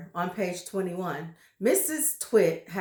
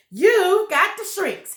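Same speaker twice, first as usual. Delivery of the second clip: much higher, louder jeering cry; 10 semitones higher, 10 dB louder than usual. Acted. Husband accusing wife of shrinks, high loud cry. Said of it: "he cried".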